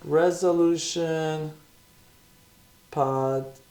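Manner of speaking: drawn out very slowly while writing it down; slow dictation. Shown as a demonstration. Host to viewers, slowly saying the podcast name while writing. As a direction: spell out Resolution as you type it. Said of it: "I should probably write that on here".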